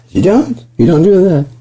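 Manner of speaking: high-pitched mock objection; mock protest. Mock protest in dark bit.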